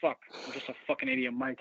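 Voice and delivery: ordinary talk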